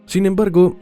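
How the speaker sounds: ordinary delivery, nothing marked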